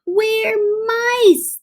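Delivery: high squeaky little mouse voices; squeaky mice. A narrator's reading. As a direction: squeak it meekly. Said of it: "they squeaked".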